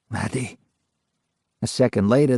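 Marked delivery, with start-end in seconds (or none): 0.1-0.6 softer, lower hushed asking; soft question. A narrator's reading. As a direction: ask the name gently, almost to yourself. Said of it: "Softly now, the ghost asked".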